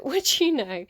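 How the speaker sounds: amused disbelief spilling into laughter; laughing. Real, not acted. Tarot reader laughing at the card that came up.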